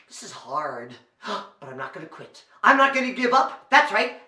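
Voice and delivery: ordinary talk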